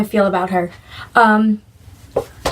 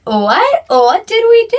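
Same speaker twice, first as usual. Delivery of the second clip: high-pitched indignant question; mock-innocent, higher and put-on; baffled innocence; 9 semitones higher, 4 dB louder, slower than usual. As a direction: act out bewildered protest at being blamed. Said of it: "look at each other like".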